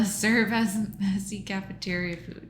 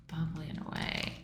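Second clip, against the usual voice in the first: fast, breathy whispered reread; quick whisper; 2 semitones lower, 8 dB quieter, much faster than usual. Real, not acted. Solver rereading the crossword clue to herself, whispered. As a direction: mutter the clue to yourself quickly.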